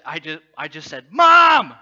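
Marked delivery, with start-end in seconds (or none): 1.1-1.7 put-on high, full-volume panicked-kid yell; yelling for mom. Stranded sixth grader calls out to mom, yelling. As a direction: scream out for your mother. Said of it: "just started yelling".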